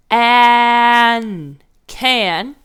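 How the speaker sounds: "an" stretched out loud, then "can" blended; teacher sounding out a word. Shown as a demonstration. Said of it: "Can is the next word".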